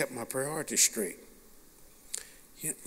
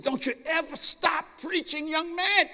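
much higher, changed voice of the man; stranger's blessing; 13 semitones higher than usual. Retold or imitated. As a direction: send him off with a charge.

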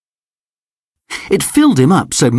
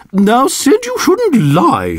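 high, slow schoolteacher reproach; teacher telling Sid off, high and slow; scolding teacher; 12 semitones higher, slower than usual. A narrator's reading. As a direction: gently scold a fibbing pupil. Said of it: "she said".

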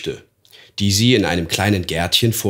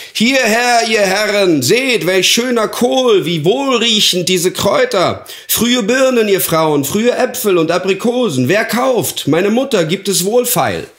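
put-on high, loud boy's market cry; eager young stall boy shouting to passers-by; calling out wares; 11 semitones higher, 4 dB louder than usual. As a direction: hawk the goods across the square. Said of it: "rief die Waren aus".

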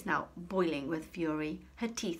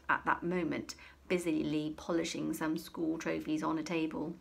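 ordinary delivery, nothing marked

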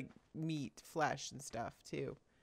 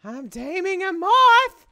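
excited squeal; 6 semitones higher, 8 dB louder than usual. Real, not acted. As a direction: announce it in a rising, thrilled cry. Thrilled while taming a moth.

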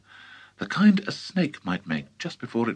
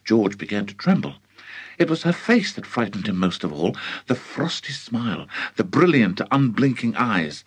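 ordinary delivery

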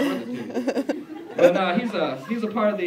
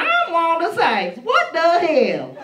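high, loud, slow indignant comic; hammed-up celebrity impression; Wanda Sykes; 12 semitones higher, 10 dB louder, much slower than usual. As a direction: stretch each word in loud, high outrage. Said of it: "I'm gonna start sounding like Wanda Sykes".

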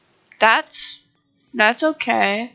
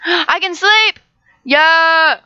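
excited cheer; 5 semitones higher, 3 dB louder, much slower than usual. Real, not acted. Finally allowed to sleep.

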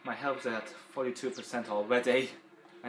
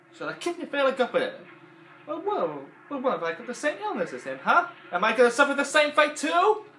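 high, loud accusing rant; Mister Potato Head voice; 14 semitones higher, 10 dB louder than usual. Retold or imitated. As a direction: turn it on the listener like Potato Head. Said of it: "he didn't wanna face the fact".

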